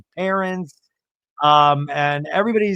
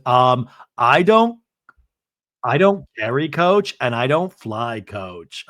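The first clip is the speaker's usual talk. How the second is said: slow, emphatic mock refusal; hammed-up, halting declaration; Lisa; 4 dB louder, slower than usual. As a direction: draw out each refusal with haughty pauses. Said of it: "Lisa goes".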